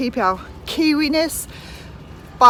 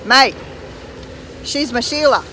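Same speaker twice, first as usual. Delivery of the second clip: Aussie slang; 3 semitones higher than usual. Shown as a demonstration. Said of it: "An Australian would say".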